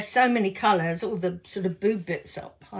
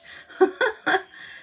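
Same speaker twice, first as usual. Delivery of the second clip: giggle; 12 semitones higher than usual. Real, not acted. Laughs at baby socks image.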